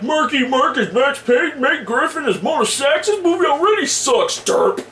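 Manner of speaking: high, mocking dumb gamer voice ending in derp; hammed-up dim gamer sneer; dismissive gamer. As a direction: parody a fan who has already written it off. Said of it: "set their standards too low, like".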